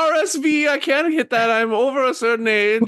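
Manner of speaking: put-on very high-pitched elderly lady; worried old woman. Game master plays old woman.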